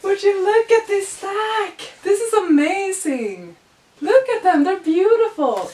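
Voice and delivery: high, loud, slow gushing; excited. Real, not acted. Showing the viewers the stack, she gushes excitedly. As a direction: gush over them like treasure.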